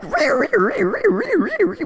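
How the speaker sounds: screeching. Retold or imitated. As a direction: shriek it like a straw squeak.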